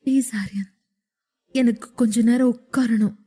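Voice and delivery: quiet, weak plea to be let up; soft pleading. A narrator's reading. Wife pleads with Aryan to let her sit up, softly. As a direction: ask him gently, almost begging. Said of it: "என்று கேட்டுக்கொண்டாள்".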